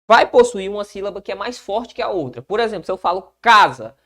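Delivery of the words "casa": "casa" with a strong first syllable; stressed first syllable. Shown as a demonstration. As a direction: punch the "ca" in "casa", let "sa" drop. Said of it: "esse K é mais forte do que o za".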